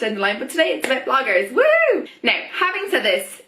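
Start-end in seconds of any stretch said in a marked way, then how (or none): 1.5-2.1 high, loud little cheer; cheerful woo. Real, not acted. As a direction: throw in a bright victory whoop. Cheering herself on.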